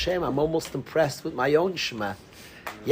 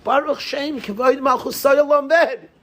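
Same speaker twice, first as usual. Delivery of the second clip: proclaimed high and loud; loud and proud; 10 semitones higher, 6 dB louder than usual. Shown as a demonstration. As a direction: call the blessing out with pride. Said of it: "Say it loud, say it proud".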